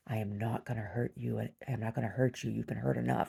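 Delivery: quiet, gentle retold reassurance, lightly voiced; softly reassuring stranger. Tattooed stranger calming the frightened dreamer, softly reassuring. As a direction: soothe her like a kind rough-looking man.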